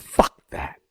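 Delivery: a hint of a rasping Sammartino; voiceless, clipped retort; mild imitation of Sammartino. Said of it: "he said".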